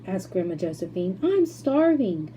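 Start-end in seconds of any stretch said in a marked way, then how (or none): 1.2-2.3 put-on old woman complaining. A narrator's reading. Grandma Josephine complaining.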